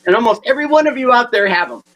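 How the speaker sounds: ordinary talk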